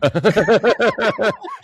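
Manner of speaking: high burst of real laughter. Real, not acted. Host reacting to a colleague's bathroom joke, high real laugh.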